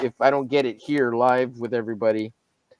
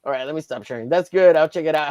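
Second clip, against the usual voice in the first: ordinary delivery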